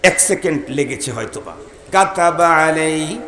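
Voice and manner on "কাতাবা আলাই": কাতাবা আলাই drawn out slowly, each letter sounded; slow recitation demo. Shown as a demonstration. Preacher showing the congregation how to recite, slow and careful. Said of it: "এখানে ছয়টা অক্ষর উচ্চারণ".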